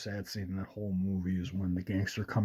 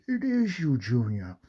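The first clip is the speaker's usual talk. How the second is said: put-on high, louder exclamation as an old movie father; Sean Connery; 4 semitones higher, 4 dB louder than usual. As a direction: mimic an old Scottish star recognizing his son. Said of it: "old Sean Connery".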